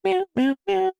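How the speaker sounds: high, squeaky meowing; nervous cat voice. Pretend. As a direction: meow like a startled kitten. Cat noises after being told off.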